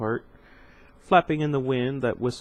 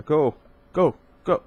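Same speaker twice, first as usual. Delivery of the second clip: Go, Go, Go called out louder, pushing her away; urgent shouted Go; shouted urging; 4 semitones higher, 7 dB louder than usual. A narrator's reading. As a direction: shout Go as a repeated push. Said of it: "She shouted".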